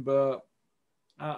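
normal talking voice

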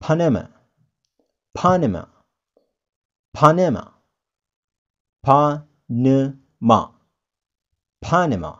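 Panama said PAN-uh-muh, stress on the first syllable; teacher modelling Panama, slowing the fourth take; English Panama. Shown as a demonstration. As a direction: Panama with a short flat middle uh. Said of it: "we pronounce it".

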